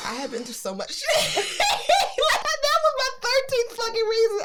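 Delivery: mock tragic laugh; laughing through embarrassment; laughing despair. Real, not acted. Laughing at rejection.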